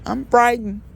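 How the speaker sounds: high, hammed-up little scared voice; mock frightened.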